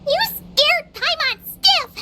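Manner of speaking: ordinary talk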